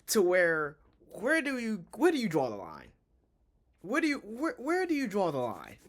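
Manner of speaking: high, loud exasperated rant. Real, not acted. Streamer venting to chat about a bad film, exasperated. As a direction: push the pitch way up in real exasperation.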